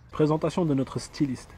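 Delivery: ordinary talk, nothing marked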